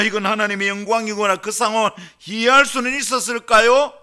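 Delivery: loud, high-pitched pulpit exclamation; full-force preaching. Real, not acted. Preacher questioning how shepherds could understand it, full-force preaching. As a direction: drive each question out loudly to the congregation.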